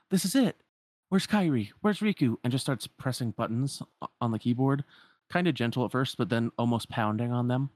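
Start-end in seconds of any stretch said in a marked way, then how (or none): 0.1-2.4 high, eager burst of questions; excited Sora. Retold or imitated. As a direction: rush in, desperate to find friends. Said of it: "and just like".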